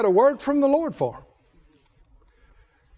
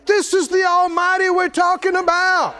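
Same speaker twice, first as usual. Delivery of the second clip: preaching shout; 7 semitones higher than usual. Real, not acted. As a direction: proclaim it with force. Preacher bursts out loud.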